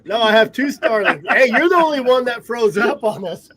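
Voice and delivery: loud good-natured objection; indignant protest. Real, not acted. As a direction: argue back loudly, in banter. Protesting the teasing about connection.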